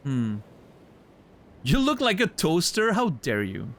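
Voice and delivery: ordinary delivery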